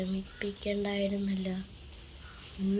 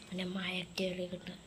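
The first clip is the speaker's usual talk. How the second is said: whispered aside; 3 semitones lower, 9 dB quieter than usual. Acted. Private aside to himself.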